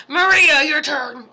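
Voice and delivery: loud, sharp call of a name; aggressively calling the next person up. Real, not acted. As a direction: bark the name out like a summons. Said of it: "I said that one just aggressively".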